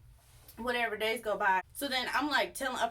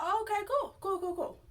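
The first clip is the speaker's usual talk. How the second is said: much higher, sing-song cool cool cool; a pleased little chirp of vindication; chirpy satisfied; 10 semitones higher than usual. Retold or imitated. Cashier hearing management will ban the man, high and chirpy. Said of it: "So I'm just like".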